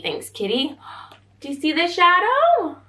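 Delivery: ordinary delivery, nothing marked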